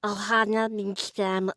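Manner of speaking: slow attempt with a slushy S in "means"; lisped character attempt. Shown as a demonstration. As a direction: try "Ohana means" slowly through a lisp. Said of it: "let me try".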